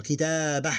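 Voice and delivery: "Kitabah" closing on a breathy h instead of "tu". Shown as a demonstration. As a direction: stretch the "ta" in "Kitabah", end on bare h. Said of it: "Because we ignore the vowel".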